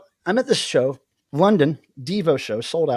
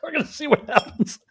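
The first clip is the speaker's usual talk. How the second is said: repeated through real laughter; laughing; 11 semitones higher than usual. Real, not acted. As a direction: say it again while cracking up.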